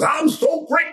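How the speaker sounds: very high, crowing boast; showman's high-pitched entrance line; Muhammad Ali boasting. Acted. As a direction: announce yourself like a cocky champ. Ali walks in boasting, acted.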